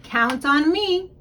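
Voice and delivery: ordinary delivery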